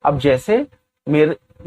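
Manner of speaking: ordinary talk, nothing marked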